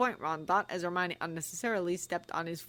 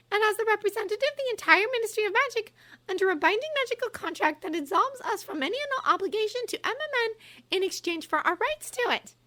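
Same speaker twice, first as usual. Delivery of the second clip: put-on high, crisp confident girl; Hermione; 6 dB louder than usual. A narrator's reading. Hermione coolly explaining the contract to Umbridge, high voice. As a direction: lay out the facts coolly.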